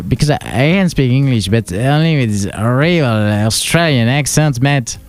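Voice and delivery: put-on slow, laid-back Australian accent. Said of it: "mon accent australien".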